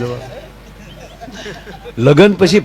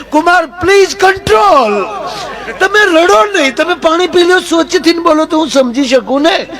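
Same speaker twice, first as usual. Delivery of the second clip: mother-in-law voice; 13 semitones higher than usual. Acted. Mother-in-law tells sobbing son-in-law to calm down, very high voice. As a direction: soothe him in a shrill high mom voice.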